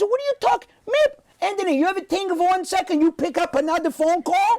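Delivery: Italian father gaslighting his son; father claiming it was another call, Italian accent; Italian dad denial. Retold or imitated. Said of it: "with that accent".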